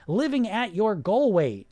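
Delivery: "Living at your goal weight" said slowly, leaning on "goal"; slow emphasis. Shown as a demonstration. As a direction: spell out "goal weight" word by word, with weight on "goal". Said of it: "Listen to those words".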